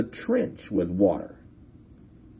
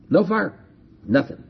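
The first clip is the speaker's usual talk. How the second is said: ordinary talk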